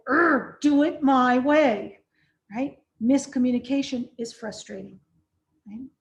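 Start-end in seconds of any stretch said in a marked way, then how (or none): 0.1-2.0 loud, slow, exasperated demand; frustrated growl. Shown as a demonstration. Trainer showing a power struggle, loud and exasperated. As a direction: growl it out like you're fed up. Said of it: "power struggle feels like".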